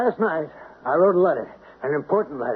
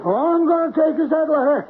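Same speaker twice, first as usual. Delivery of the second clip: forceful demand; 12 semitones higher than usual. Acted. Grabbing at the mailman's bag.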